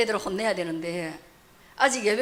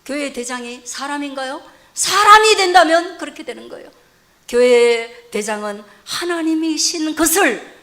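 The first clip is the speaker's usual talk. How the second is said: loud, emphatic sermon delivery; forceful preaching; 5 semitones higher, 13 dB louder than usual. Real, not acted. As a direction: preach it out, punching the key words.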